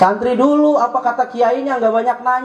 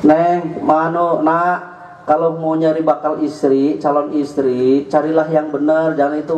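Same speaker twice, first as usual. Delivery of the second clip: put-on mother advising her child; 10 semitones lower than usual. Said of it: "Kata maknya".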